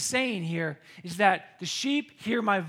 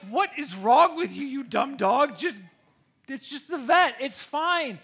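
exasperated scolding; 5 semitones higher, 6 dB louder than usual. Retold or imitated. Scolding her scared dog.

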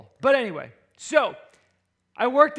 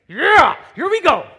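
high, very loud hype yell; psyched-up shout; 9 semitones higher, 12 dB louder than usual. Acted. Lifter getting geared up with [name], psyched-up shout. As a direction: roar it out to pump yourself up. Psyching himself up before lift.

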